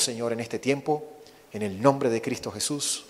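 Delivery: ordinary delivery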